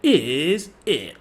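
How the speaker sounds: lightly imitated smooth host voice with dramatic pause; a hint of a game show host; mock dating show host. Mocking Casey's voice, a touch of a smooth host. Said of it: "this guy's voice".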